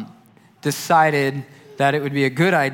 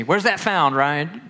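quizzing Southern; 4 semitones higher, slower than usual. Retold or imitated. Larry quizzing Ryan.

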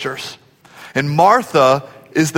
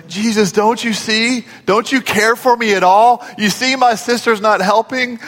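higher-pitched whiny pleading; mock tattling tone; 4 semitones higher than usual. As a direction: act out a sister telling on her sibling. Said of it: "that's the tone I sense here when she says".

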